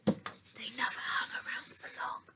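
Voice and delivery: soft breathy closing whisper; hushed storybook ending. A narrator's reading.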